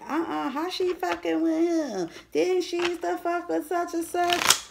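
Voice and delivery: hammed-up jealous gossip; gossiping jealous women. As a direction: imitate a catty gossip who can't believe it. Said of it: "the bitches is mad like".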